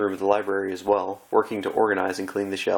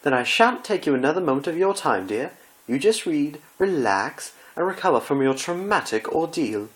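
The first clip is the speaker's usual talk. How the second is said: high, anguished theatrical gasp; dismayed Rarity; 7 semitones higher than usual. Acted. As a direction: wail as if the world is ending.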